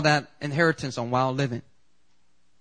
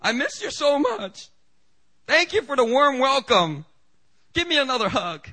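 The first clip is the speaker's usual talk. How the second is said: high, hammed-up gushing voice; mock gushing son; 9 semitones higher, 3 dB louder than usual. Preacher mocks the son gushing thanks to his father, high and hammed-up. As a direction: ham up a syrupy reunion. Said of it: "start crying and sobbing".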